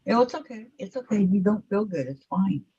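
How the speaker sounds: calm her down tenderly; gentle reassurance. Real, not acted. Comforting a sick friend apologizing.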